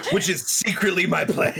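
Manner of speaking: words bubbling through giggles; real laughter. Real, not acted. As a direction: admit it while cracking up. Laughing while admitting a plot.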